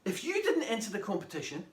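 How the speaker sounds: lively read-aloud storytelling; story narration. Acted. Author reading chapter one to young listeners, lively storytelling. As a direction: read it to a room of children, animated.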